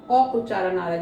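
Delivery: ordinary talk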